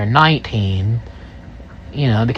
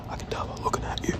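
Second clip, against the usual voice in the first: whisper; 18 dB quieter, much faster than usual. Retold or imitated. Stranger confides about smoking.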